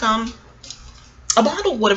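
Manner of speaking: ordinary talk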